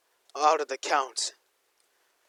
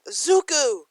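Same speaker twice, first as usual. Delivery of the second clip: called out much higher and louder; joyful yell; 12 semitones higher, 8 dB louder than usual. Acted. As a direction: shout his name in happy surprise. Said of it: "and they both yell".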